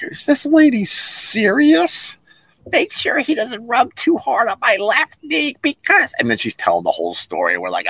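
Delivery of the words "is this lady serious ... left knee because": high, slow, incredulous parody of her voice; mocking the lady. Guests mocking the fussy lady with a high slow voice. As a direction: ape her words back in disbelief. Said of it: "we're all looking around like".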